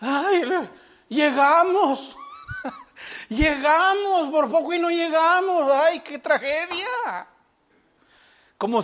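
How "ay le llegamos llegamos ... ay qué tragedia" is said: mock moaning, higher and slow, with a laugh breaking in; hammed-up tragic whine. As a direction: drag out the words like a martyr barely arriving. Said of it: "así vienen hasta cuando piden la oración".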